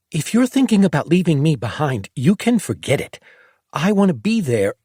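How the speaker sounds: put-on high, insistent young girl's voice; a determined kid refusing to be left out; defiant girl. A narrator's reading. Narrator voicing a stubborn girl as she insists on coming along. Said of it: "stop her burgeoning tirade".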